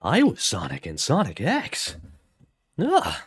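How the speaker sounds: exaggerated up-and-down actor impression; Jason. Voice actor recalling his Sonic role, in swooping parody. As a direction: swoop the pitch wildly word by word. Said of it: "That's how Jason talks".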